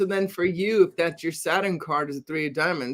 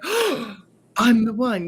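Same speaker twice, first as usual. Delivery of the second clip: gasp, then a higher awed realization; sudden revelation; 5 semitones higher, slower than usual. Retold or imitated. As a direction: imitate someone gasping at a grand discovery. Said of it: "they're like".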